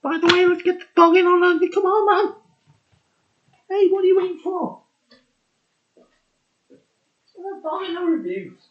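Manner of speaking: very high-pitched pet voice; excited dog-talk. Real, not acted. Beer reviewer calling the dog into the video, high excited pet voice. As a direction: squeal it eagerly to get the dog over.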